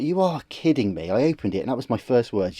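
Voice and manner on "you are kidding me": higher-pitched mock disbelief; retold disbelief. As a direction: replay an incredulous first reaction. Quoting his reaction on opening it.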